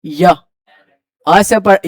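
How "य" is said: य said alone as a short "ya" glide; isolated first sound. Shown as a demonstration. Teacher showing students how university begins, य as an isolated "ya" sound. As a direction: sound out य by itself, apart from the word. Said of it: "यूनिवर्सिटी य से पढ़ते हैं".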